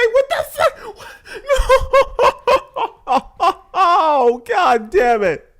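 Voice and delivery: helpless outraged laughter; laughing yelling. Real, not acted.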